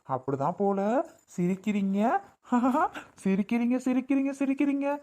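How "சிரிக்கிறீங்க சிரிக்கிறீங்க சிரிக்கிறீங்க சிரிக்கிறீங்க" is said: put-on high, lilting coo to a baby; doting grandparent's baby talk; playful baby talk. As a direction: delight a grandchild with sing-song chuckles.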